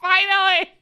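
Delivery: high, loud cry with laughter; exultant relief. Real, not acted. Player finally gets an easy accent, exultant and laughing. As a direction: burst out laughing in triumph.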